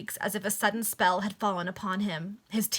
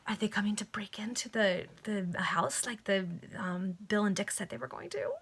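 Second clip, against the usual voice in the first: worried whisper; 4 dB quieter than usual. Real, not acted. Reader asks aside, whispering.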